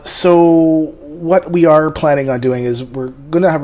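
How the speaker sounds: ordinary delivery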